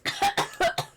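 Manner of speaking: sharp choking cough. Acted. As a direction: cough on the spice. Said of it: "spice stuck in my throat".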